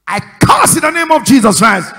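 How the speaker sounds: sudden roaring deliverance prayer; shouted prayer command. Real, not acted. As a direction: thunder it out as a curse in prayer. Prayer cursing spiritual deafness.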